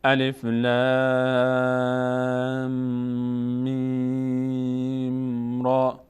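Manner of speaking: الف، لام held long and slow; stretched Quranic letter names; slow recitation. Shown as a demonstration. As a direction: recite each name drawn out.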